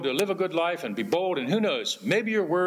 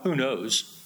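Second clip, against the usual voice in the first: doubtful shrug. Retold or imitated.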